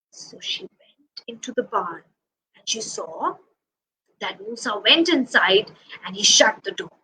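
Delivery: whispered, low and hushed narration; storyteller whisper. A narrator's reading. Narrator sneaking into the barn with the lamb, whispered. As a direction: whisper it like a secret to the children.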